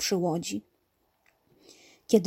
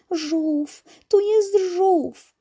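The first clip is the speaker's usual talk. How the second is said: high, drawn-out cry of discovery; little one's excited exclamation; 10 semitones higher, 5 dB louder, much slower than usual. A narrator's reading. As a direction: call it out high and slow, thrilled. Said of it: "chciała krzyknąć Pola".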